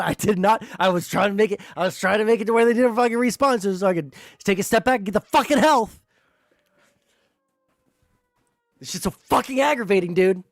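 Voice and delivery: real gamer rage; frustrated rant. Real, not acted. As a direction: rant it out, fed up. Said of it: "I'm so fucking mad".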